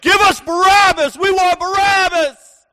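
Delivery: high, loud mob chant; crowd shouting. Retold or imitated. Imitating the crowd demanding Barabbas from Pilate, high loud shout. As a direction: bellow it like a whole crowd demanding. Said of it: "they all shouted".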